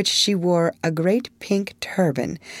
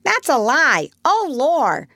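high loud parrot squawk; crying parrot; 9 semitones higher, 3 dB louder than usual. A narrator's reading. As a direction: screech it like an indignant parrot. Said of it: "cried the parrot".

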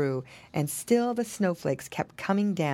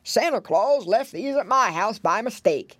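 put-on old pig voice; wavering, slurred grandfather character; Grandpa Squealer; 3 semitones higher than usual. A narrator's reading. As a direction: explain it as the oldest pig. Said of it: "there stood Grandpa Squealer".

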